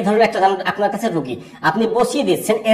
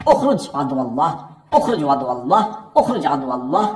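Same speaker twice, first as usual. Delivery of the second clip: repeated exorcism command; 4 semitones lower than usual. Shown as a demonstration. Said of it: "এভাবে পড়বেন".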